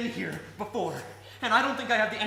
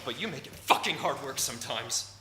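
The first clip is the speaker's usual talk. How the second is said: ordinary talk, nothing marked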